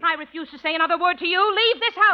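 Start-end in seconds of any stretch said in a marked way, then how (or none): 1.6-2.1 higher, curt dismissal; angry order. Acted. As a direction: point him to the door. Throws him out.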